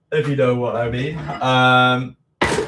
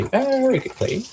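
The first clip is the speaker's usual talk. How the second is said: squeaky high; 13 semitones higher than usual. Real, not acted. Rushing to wash.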